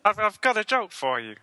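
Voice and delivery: high frog puppet voice; Kermit the Frog. Acted.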